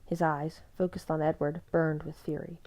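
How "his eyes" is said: ordinary delivery, nothing marked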